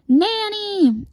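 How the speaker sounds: very high drawn-out cry; piercing high call; scream. A narrator's reading. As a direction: scream out for help from Nanny. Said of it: "And then he screams".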